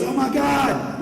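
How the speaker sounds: high, loud yell of terror; terrified yell. Retold or imitated. Professor yelling at the bear, high and loud in terror. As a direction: yell it as the bear's claws come down. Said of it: "and he yells".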